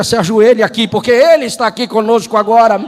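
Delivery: a fervent altar call; preaching at full force. Acted. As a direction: preach it and push toward the climax. Preaching climax.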